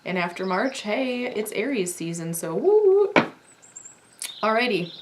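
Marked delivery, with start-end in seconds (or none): 2.5-3.1 high, loud cheer; excited woo. Real, not acted. Cheering her Aries viewers on, loud and high. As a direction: whoop it up for Aries season.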